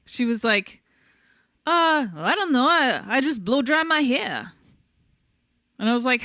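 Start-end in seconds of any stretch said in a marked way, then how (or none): 1.7-4.5 a lightly put-on Queens accent, higher; hint of a Queens drawl on the quote; Queens accent impression. A lightly done Queens accent, imitating the bartender. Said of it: "that was my impression right there".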